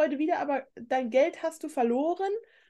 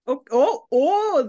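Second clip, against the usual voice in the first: excited; 3 semitones higher, 8 dB louder, slower than usual. Real, not acted. Excited about Sarah's grade.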